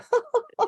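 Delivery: very high-pitched affected society laugh; posh laugh. Shown as a demonstration. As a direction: demonstrate the proper fancy RP laugh. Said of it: "You've got to".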